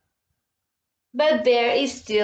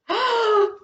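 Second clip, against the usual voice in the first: sharp high storybook gasp; dramatic gasp; 10 semitones higher than usual. Shown as a demonstration. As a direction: gasp for the kids. Reading to kids, dramatic pause.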